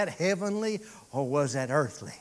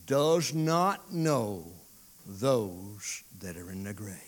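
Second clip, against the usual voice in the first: ordinary talk, nothing marked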